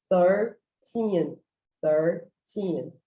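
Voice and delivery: put-on THIRTEEN split slowly, 'THUR... TEEN'; slow two-part thirteen, falling on TEEN; TH then normal T. Shown as a demonstration. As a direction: say thirteen with tongue-tip TH, then a crisp T. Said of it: "você tem o T H no início e o outro T normal depois".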